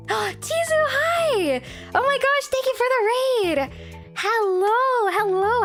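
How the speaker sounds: high, bright excited raid greeting; cute anime streamer. Real, not acted. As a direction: squeal a delighted hello to the raiders.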